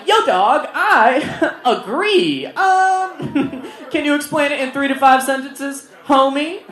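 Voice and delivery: high, loud voice breaking into laughs; high-pitched mock-supportive teacher; awkward agreement.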